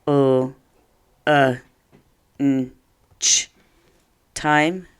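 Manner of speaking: O, uh, n, ch, time said piece by piece; sounding out. Shown as a demonstration. Teacher checking the spelling sound by sound. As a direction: break lunchtime into its sounds. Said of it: "Did you write".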